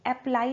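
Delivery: "अप्लाई" with a full a as in apple; wrong first vowel. Shown as a demonstration. Teacher recalls the mistake to avoid, full first vowel. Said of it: "अप्लाई नहीं".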